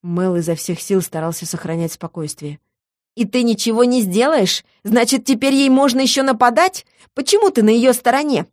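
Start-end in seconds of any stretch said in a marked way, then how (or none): 3.2-8.5 high-pitched, aggrieved questioning; woman's edgy, hysterical protest; hysterical notes. A narrator's reading. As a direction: demand answers with rising hysteria. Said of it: "В голосе женщины прорезались истеричные нотки".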